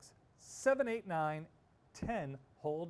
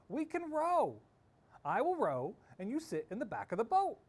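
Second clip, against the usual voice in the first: high, loud and keen; child pleading to row, pitched up loud; eager insistence; 9 semitones higher, 6 dB louder than usual. Acted. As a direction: push the idea with bright excitement.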